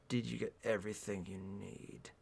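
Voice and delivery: low, very soft question; hushed, deep male character; lightly voiced leading man. A narrator's reading. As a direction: murmur it low, with quiet control. Voices Gray in the story.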